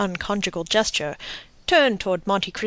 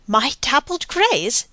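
higher, slow, incredulous question; indignant demand; 5 semitones higher, slower than usual. A narrator's reading. Baroness learning her horses were sold, indignant demand. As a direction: demand it in stretched-out disbelief. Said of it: "demanded the Baroness".

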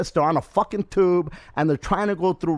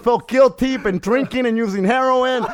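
mock worried rant; 7 semitones higher, 6 dB louder than usual. Acting out his worried rant.

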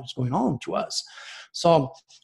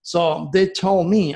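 usual speaking voice

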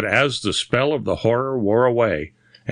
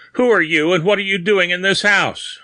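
high, stern questioning; indignant interrogation; cold demand; 9 semitones higher, 4 dB louder than usual. A narrator's reading. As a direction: coldly challenge an intruder. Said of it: "he said coldly".

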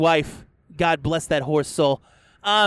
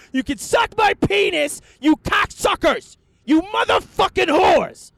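high, loud, drawn-out screaming insults; furious shout; 13 semitones higher, 5 dB louder than usual. Acted. Podcaster screaming insults at Walmart, furious. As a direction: let them have it at the top of your voice.

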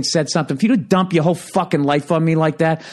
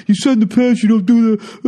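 high, louder mock-indignant voice; mocking complainer; 7 semitones higher, 4 dB louder than usual. Offended audience member confronting the comic, mockingly indignant. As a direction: imitate an outraged person scolding you.

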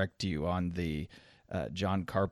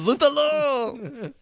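put-on French accent; 5 dB louder, much slower than usual. Joins the French bit.

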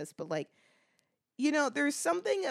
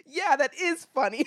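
amused approval; 7 semitones higher, 5 dB louder than usual. Retold or imitated. Enjoying the Canada jokes.

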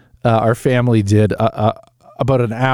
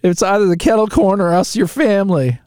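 mock intervention plea; 6 semitones higher than usual. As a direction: play the hurt relative, hammed-up. Acting family at intervention.

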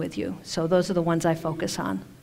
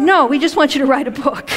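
laughing; 7 semitones higher, 4 dB louder than usual. Retold or imitated. Amused voicing of guides' reply.

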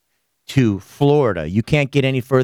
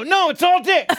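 much higher, rising yelp of alarm; mock panic. The host telling Natasha not to look, high laughing alarm. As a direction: warn her off in a laughing panic. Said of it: "I go".

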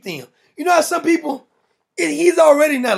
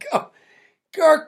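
ordinary delivery

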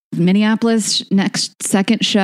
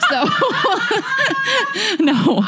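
laughing. Real, not acted. Cracking up mid-promo.

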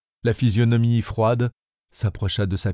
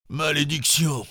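higher cry of malediction; cursing cry; 6 semitones higher than usual. Acted. Farragut cursing the failed shot, angry cry. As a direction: spit out the curse. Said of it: "s'écria le commandant Farragut".

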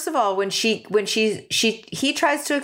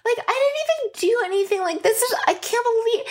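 hammed-up protesting innocence, pitched way up; Genevieve; 13 semitones higher than usual. Host imitates a contestant insisting she did nothing, high-pitched protest. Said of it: "Genevieve is just like".

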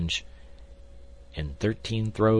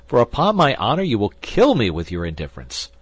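high, loud protest; exasperated outburst; 5 semitones higher, 9 dB louder than usual. A narrator's reading. D'Artagnan driven wild by his friend's indifference, exasperated.

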